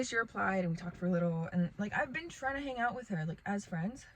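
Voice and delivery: ordinary delivery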